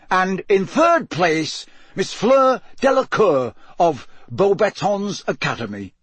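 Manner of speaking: high, ringing master of ceremonies; magnified announcer. A narrator's reading. Bagman announces the standings to the stands, magnified announcer voice. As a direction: project to the stands, pitched high and drawn out. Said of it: "his magically magnified voice echoed".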